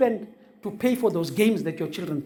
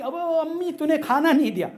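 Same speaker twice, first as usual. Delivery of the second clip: mock child complaint; 9 semitones higher than usual. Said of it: "बाद में बोलते कि".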